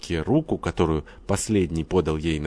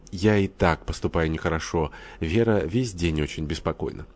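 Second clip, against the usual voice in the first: ordinary delivery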